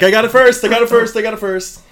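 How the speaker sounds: high, loud, repeated playground-style boast; gleeful claim. Acted. Musician claiming the stage name before his bandmate, loud and excited. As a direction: crow it like a kid calling dibs. Acted rush to claim nickname first.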